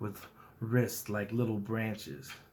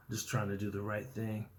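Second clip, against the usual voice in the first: usual speaking voice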